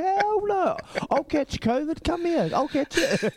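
high, squeaky, hammed-up eagerness; mock eager husband. Husband mocking himself to his mate, high and hammed-up. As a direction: ham up a silly high voice begging for the germs.

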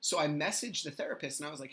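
ordinary delivery

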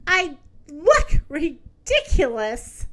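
slow, stunned and crushed; the hippo seeing her dream face; dismayed realisation. Acted. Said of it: "she cried out".

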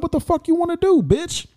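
high-pitched retold challenge; confrontational challenge.

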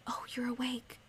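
soft breathy whisper, barely voiced; quietly whispered greeting at dawn. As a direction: hush it like someone might still be asleep. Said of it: "And he comes over and whispers".

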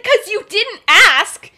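loud, emphatic defence, word by word; indignant comeback. Acted. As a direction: hammer out an exasperated retort. [name] acted defending himself.

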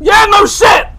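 high loud sarcastic shout; loud retort. Real, not acted. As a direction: yell back at the game. Retort to game voice.

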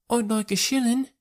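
put-on high-pitched small child voice; little boy. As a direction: pipe up like a six-year-old lad.

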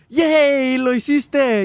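grito agudo de alegría, actuado; festejo imitado. Retold or imitated. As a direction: celebra como caricatura, fuerte y muy agudo. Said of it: "las chicas superpoderosas estaban como". (porra aguda y eufórica de imitación)

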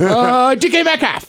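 high, flustered panic answer; panicked blurt. Retold or imitated. Retelling a customs officer question, a high panicked blurt.

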